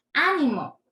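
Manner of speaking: Á-nimo, strong start and falling end; ánimo with all the force up front; stress on first Á. Shown as a demonstration. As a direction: punch the opening Á of ánimo. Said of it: "le tienen que poner fuerza".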